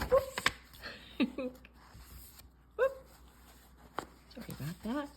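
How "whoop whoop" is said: plain reading voice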